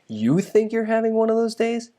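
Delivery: mock indignant inner rant. Ranting inwardly at Careless Carol, high mocking scoff. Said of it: "I started to think".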